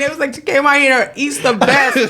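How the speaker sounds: normal talking voice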